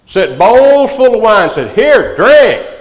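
high, loud, slow, eager host pushing the wine; hammed-up party dad handing out bowls; tipsy dad offering drinks. Preacher mocking a liquor-loving dad, loud and high.

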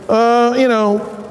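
hesitant, casual mumbled suggestion; offhand, slow-starting and unexcited; half-hearted thanks. Shown as a demonstration. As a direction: offer it limply, without emphasis. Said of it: "He didn't say".

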